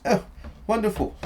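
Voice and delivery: high, delighted exclamation; pleased Doctor. Acted. Doctor emptying out his recovered bag, delighted. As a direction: raise the pitch and exclaim with pleasure.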